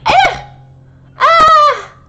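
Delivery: loud high scream of fright; real scream of fright; startled shriek. Real, not acted. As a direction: shriek at the sudden scare. Startled by sudden jump scare.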